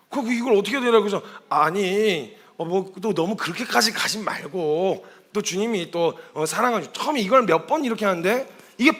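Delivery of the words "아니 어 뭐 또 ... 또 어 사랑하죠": soft, slow, halting reassurance; hesitant consoling. Acted. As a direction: console gently, groping for the words. Awkwardly consoling the self-berating man.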